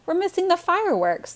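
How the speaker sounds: high-pitched, excited complaint; worked-up, high kid's protest; urgent protest. A narrator's reading. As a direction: plead as if the show is slipping away. Kid complains about missing fireworks.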